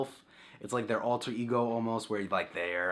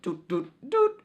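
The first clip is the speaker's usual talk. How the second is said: rising, much higher beeping, last doot loud; imitated save beeps; 13 semitones higher, 5 dB louder, much slower than usual. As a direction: mimic the Game Boy save jingle. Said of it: "it made the sounds".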